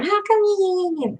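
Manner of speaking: high, slow mock whine of Patty; playful imitation of a friend's complaint; mimicking Patty. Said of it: "She's always like".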